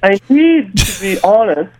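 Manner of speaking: high, slow put-on Australian; fake Australian accent. Caller starting confession to girlfriend, fake Australian accent. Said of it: "you don't actually have a, an Australian accent".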